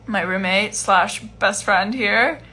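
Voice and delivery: ordinary talk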